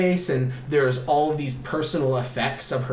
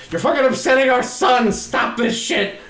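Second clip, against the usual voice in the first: high, loud retold outrage, slowing at the end; mock furious order to stop; angry; 8 semitones higher, 4 dB louder than usual. Husband to mother over their upset son, angry.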